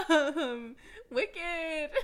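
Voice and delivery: loud, laughing wicked. Real, not acted. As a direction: crack up on the word. Laughing after singing.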